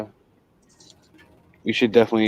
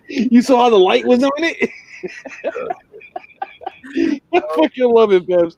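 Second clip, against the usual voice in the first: laughing; 14 semitones higher, 4 dB louder than usual. Real, not acted. Giggling over a friend's comment.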